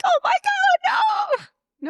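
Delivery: very high, hammed-up horrified cry; mock horror. Money coach sister reacts to cashing out retirement, mock horrified.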